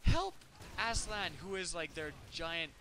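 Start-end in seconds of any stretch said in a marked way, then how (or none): 0.0-1.3 high, mock-desperate plea for help; lightly voiced frightened girl; mock girl pleading. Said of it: "so the girl goes".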